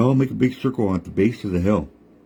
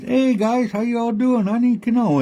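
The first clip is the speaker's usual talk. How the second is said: much higher, smooth trickster voice; high, casual trickster coyote; sly Coyote; 13 semitones higher than usual. Acted. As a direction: greet the frogs like a friendly con man. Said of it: "And he says".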